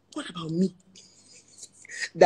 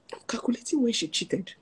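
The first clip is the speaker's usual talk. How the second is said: hushed whisper; 4 semitones higher, 7 dB quieter than usual. Retold or imitated. Therapist imitates heartbroken client, whispering his obsession. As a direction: murmur it under your breath, unvoiced. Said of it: "This was how he was".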